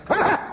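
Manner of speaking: sudden very high bark of a laugh; first startled laugh. Retold or imitated. Newly sober man's first laugh at a meeting, startled high bark. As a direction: let one rusty laugh burst out. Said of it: "I went".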